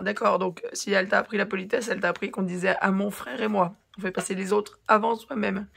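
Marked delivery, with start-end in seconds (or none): none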